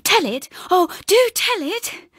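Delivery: high, pleading squeal, slowing on do tell it; delighted squeal. A narrator's reading. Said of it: "squealed with delight".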